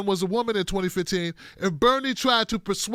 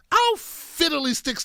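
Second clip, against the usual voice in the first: mock prissy dismay; 6 semitones higher, slower than usual. Mocking a critic.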